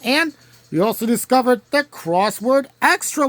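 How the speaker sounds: ordinary talk, nothing marked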